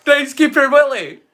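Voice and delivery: mock cheer for representation. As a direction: hail a hero, half-joking. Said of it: "but Scottish people, we're like".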